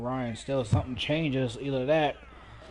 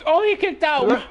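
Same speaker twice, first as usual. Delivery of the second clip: much higher and louder burst of shock; stunned commentator; 14 semitones higher, 9 dB louder than usual. Acted. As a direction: shout the kickout in disbelief. Shock at the kickout.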